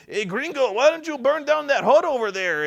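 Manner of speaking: high, loud mock Mexican accent for the warlord. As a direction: ham up a Mexican bandit boss giving orders. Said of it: "he's like".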